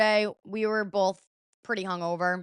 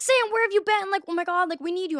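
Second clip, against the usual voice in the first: high-pitched, urgent retold clamour; frantic friends calling; 10 semitones higher than usual. Friends swarm Sam on her return, frantic. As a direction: imitate worried friends crowding round, pitch way up.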